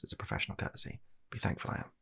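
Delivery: usual speaking voice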